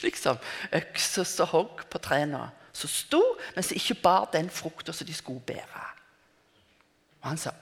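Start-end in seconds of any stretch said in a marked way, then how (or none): none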